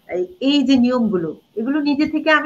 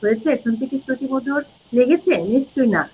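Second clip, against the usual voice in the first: usual speaking voice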